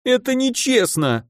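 high-pitched wounded protest; acted, almost childish sob; childlike whimper. A narrator's reading. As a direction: whine it high, like a sulking child. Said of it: "почти по-детски всхлипнул архимаг".

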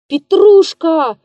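loud, slow, high call; shouted name. A narrator's reading. Frightened actor shouting for the stagehand, loud call. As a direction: yell the name slowly across the hall. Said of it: "крикнул он".

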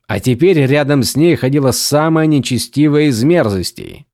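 ordinary delivery, nothing marked

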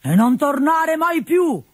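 put-on high, slow, drawn-out old man's roar; old Pantalone throwing out a servant, roaring high and slow. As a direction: bellow him out the door like a mean old miser. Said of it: "ruggì un vecchio".

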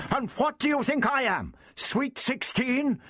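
very high outraged cry, no added volume; indignant roar. A narrator's reading. The Doctor retorts to old Prapillus, roaring in outrage. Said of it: "the doctor roared".

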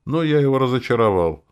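plain reading voice

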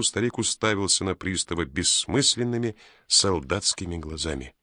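plain reading voice

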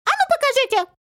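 high, piercing demand; squeaky shout. A narrator's reading. Little man demands to see herb, shrill cry. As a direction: demand it in a tiny creature voice. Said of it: "закричал он".

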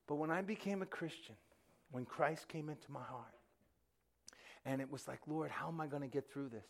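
soft, low confession; hushed. Real, not acted. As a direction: drop right down, intimate. Quiet testimony of conversion.

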